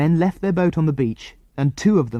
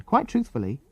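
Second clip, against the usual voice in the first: usual speaking voice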